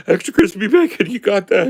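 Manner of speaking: high, loud mock-tearful waitress; whining and crying. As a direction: take the order through hammed-up sobs. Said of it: "she's whining and crying to every customer".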